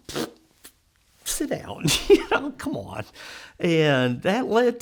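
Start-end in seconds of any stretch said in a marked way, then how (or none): 0.1-3.0 lightly hammed dismissal; mock dismissive. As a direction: wave her down with a scoff. Board dismissing, high far.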